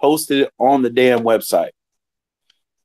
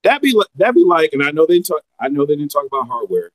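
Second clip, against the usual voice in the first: usual speaking voice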